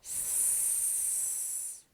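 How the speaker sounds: С held as a long, soft voiceless hiss; isolated С sound. Shown as a demonstration. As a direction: draw out С with no vowel after it. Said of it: "Перший звук". (протяжне тихе глухе С)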